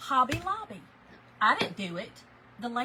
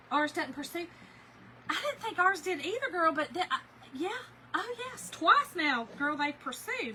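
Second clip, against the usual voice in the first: ordinary talk, nothing marked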